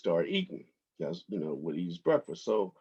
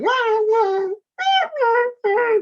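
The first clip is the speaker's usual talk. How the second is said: high, loud whining wah-wah squawk; 8 dB louder, much slower than usual. Retold or imitated. Owner imitating his parrot's complaining whine. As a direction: wail it like a whining bird. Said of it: "like, whining".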